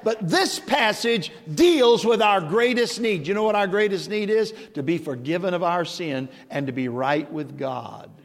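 usual speaking voice

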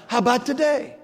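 today lifted high and hit hard; stress on today. Acted. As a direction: lean into today as the promise. Repeats with weight on today.

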